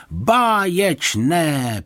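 high, very drawn-out cheer; delighted exclamation. A narrator's reading. Neighbour slapping his knee at the trick, delighted drawn-out praise. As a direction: stretch the word out with delight.

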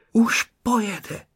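ordinary delivery

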